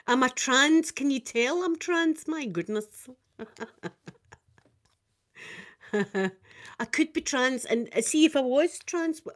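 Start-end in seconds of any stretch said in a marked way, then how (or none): 3.4-4.1 soft trailing laughter; quiet chuckle after a joke. Real, not acted. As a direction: laugh softly at your own joke.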